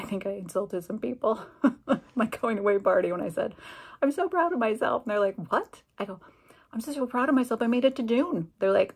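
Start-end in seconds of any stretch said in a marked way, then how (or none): 4.0-5.0 high, louder, gleeful boast; exclaimed pride, pitched high; retold proud announcement. 5.5-5.8 high-pitched surprised query; retold incredulous reaction; imitated disbelief. Colleagues react to her boast. 6.7-8.5 high, gleeful boast, louder at the end; acting out elated pride; retold proud insistence. Repeats her boast to colleagues.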